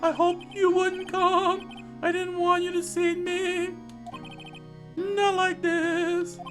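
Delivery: high, slow melodramatic anguish; anguished shame. Acted. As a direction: wail it slowly in a high voice. Prisoner ashamed to be seen jailed.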